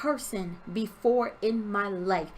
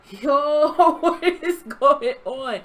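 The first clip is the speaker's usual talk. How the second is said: loud, drawn-out disbelief; acting out stunned outrage; retold shock; 3 semitones higher, 7 dB louder, much slower than usual. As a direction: stretch it out, loud and incredulous. Retold shock at the drilling man.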